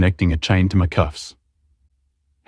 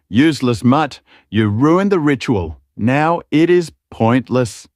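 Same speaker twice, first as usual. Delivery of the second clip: higher, slowing contempt; screaming; 5 semitones higher than usual. Acted. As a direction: spit it out, dragging the last words. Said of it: "The voice screamed out again".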